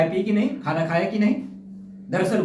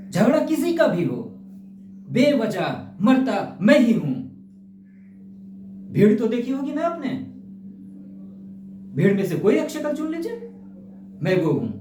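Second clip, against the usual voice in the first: raised, emphatic protest; aggrieved; 2 semitones higher than usual. Acted. Common man pleading his case to Rathore, aggrieved.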